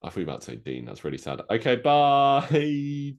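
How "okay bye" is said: very slow, higher, louder sing-song bye; a long, high, cheery sign-off; drawn-out goodbye. Real, not acted.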